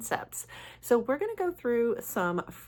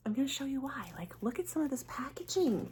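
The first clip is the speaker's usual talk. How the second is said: whisper; 7 semitones higher, 5 dB quieter than usual. Real, not acted. Excitedly showing off packaging.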